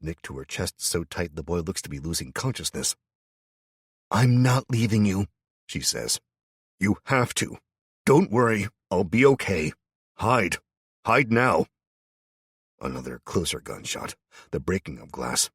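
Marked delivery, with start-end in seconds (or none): 4.1-5.3 raised, high insistence; pleading refusal. A narrator's reading. Wife refuses to leave. 6.8-11.7 raised, high urgent voice; urgent pleading. A narrator's reading. Ed urges his wife.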